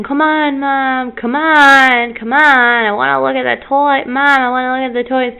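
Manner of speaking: imitated child's insistent pleading; pestering child. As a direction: nag like a kid tugging at mom. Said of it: "she kept saying ... she kept bugging me".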